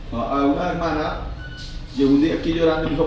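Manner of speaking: normal talking voice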